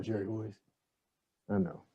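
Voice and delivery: ordinary delivery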